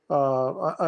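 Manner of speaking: ordinary talk, nothing marked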